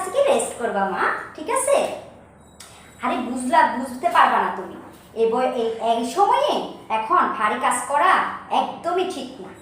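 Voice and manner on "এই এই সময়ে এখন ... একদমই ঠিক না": normal talking voice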